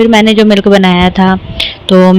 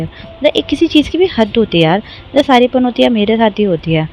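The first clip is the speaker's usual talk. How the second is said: ordinary delivery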